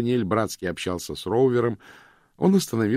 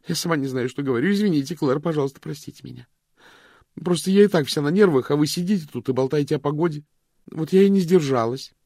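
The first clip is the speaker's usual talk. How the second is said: frantic apology; 8 semitones higher, 6 dB louder than usual. Acted. Vivien frantic apology, on edge.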